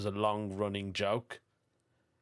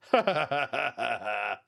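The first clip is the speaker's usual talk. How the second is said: rapid, even ha-ha-ha laugh; staccato laugh. Real, not acted. Podcast host amused by a listener's fake British persona, staccato laugh. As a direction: laugh out in a quick rattle.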